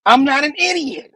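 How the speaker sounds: hammed-up villain punchline, high; Goblin. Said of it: "Goblin's like".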